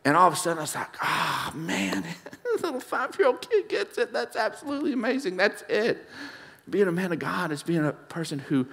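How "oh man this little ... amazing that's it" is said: amazed laugh. Retold or imitated. Amazed reaction retold.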